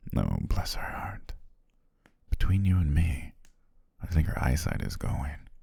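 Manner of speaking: usual speaking voice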